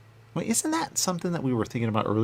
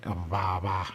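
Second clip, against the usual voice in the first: usual speaking voice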